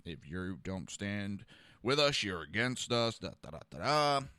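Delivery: low, soft, dismissive recital fading into da-da; mock politically correct. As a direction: recite it low and quiet, as empty talk. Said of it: "he was politically correct".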